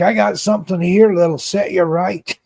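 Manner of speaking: a hint of a Scottish accent, selling a drink; mock Scottish accent. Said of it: "I can't do the Scottish accent".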